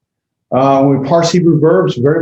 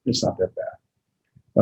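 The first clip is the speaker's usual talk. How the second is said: soft, slow whispered reassurance; a slow whisper that says don't worry; reassuring whisper; 3 semitones lower, 10 dB quieter, much slower than usual. Shown as a demonstration. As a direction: breathe it slowly, almost no voice. Reassuring students about Hebrew.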